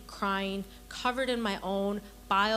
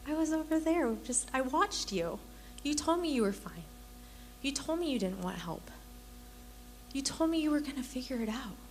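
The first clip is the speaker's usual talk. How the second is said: higher-pitched, tender parent voice; loving dad; 6 semitones higher, 4 dB quieter than usual. Retold or imitated. Dad answering his bawling son, lovingly. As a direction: imitate a dad gently reassuring his child. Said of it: "just so lovingly".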